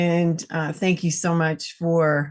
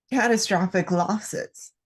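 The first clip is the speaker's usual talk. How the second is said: ordinary delivery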